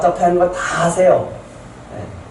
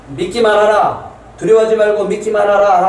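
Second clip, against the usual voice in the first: proclaiming; 6 semitones higher, 3 dB louder than usual. Acted. Preacher proclaiming Jesus' words to the congregation, loud. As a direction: ring out the command.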